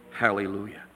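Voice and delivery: ordinary delivery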